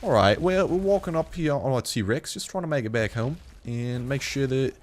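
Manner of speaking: playful mock-British commentary; British accent. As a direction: narrate your walk like a Brit. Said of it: "Now I'm talking like a true Brit".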